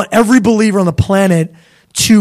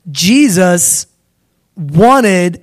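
ordinary delivery

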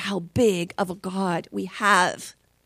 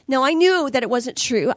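ordinary talk

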